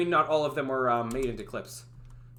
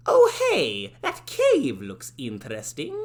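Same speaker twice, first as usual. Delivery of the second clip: cheery cartoon shopkeeper voice; 4 semitones lower, 4 dB louder, slower than usual. Game character tells the player about the balloon cart, cheerful. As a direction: sell it like a bubbly game guide. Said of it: "doing a voice a like a this".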